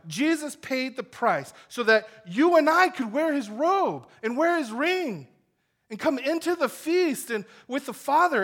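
loud, high impassioned preaching; full-force preaching. Acted. Preacher pressing the gospel loudly on the congregation. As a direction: drive it home to the congregation.

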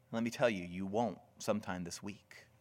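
ordinary delivery

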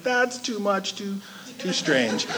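shy away from it quietly, faltering; uneasy murmur. Acted. Congregation reacting to pastor's foot washing, uneasy murmur.

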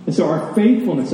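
ordinary talk, nothing marked

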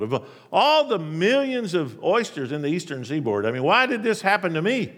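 loud, high, aggrieved protest; indignant oyster crying out at injustice; heated complaint. Acted. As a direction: demand to know why me, with heat. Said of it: "the oyster might have said with considerable heat".

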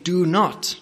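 "Do not." said high and firm; emphatic scriptural command; stress on not. Shown as a demonstration. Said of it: "note first how our text starts".

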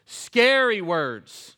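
drawn-out loud scary words; slow ominous stress. Shown as a demonstration. Pastor warning the congregation about Scary words. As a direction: lean hard on each word, very slowly. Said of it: "So scary words in the Bible".

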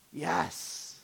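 hushed, breath-heavy answer from the side of the mouth; professor's aside. Retold or imitated. Theology professor answering his own either-or question, imitated hushed aside. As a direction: imitate a professor's sly stage-whispered aside. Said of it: "put his hand on the side of his mouth".

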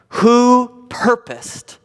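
purposed stretched out, slow and high; drawn-out emphasis. Real, not acted. Preacher to congregation, stressing that God purposed it, slow and emphatic. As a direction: lean hard on purposed, very slowly.

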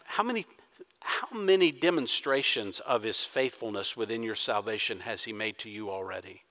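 quiet earnest preaching. Real, not acted.

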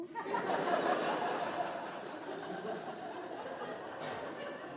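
airy high-pitched giggle; high breathless laugh. Real, not acted. Preacher cracks up at his own joke, breathless high giggle.

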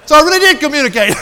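high, loud, fast laughing line. Real, not acted. Wrapping up the waiter story, laughing loudly. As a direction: still cracking up as you say it. After punchline.

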